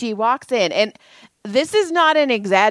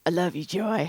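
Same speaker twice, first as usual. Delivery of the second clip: retold tenderness; 2 semitones lower, 7 dB quieter than usual. Friend holding hands with her, softly tender. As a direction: drop the volume for an imitated tender aside.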